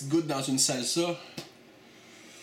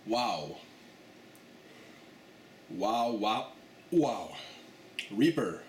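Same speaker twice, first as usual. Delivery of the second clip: mouth burning; 2 semitones lower, 5 dB quieter, much slower than usual. Real, not acted. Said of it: "Ça brûle".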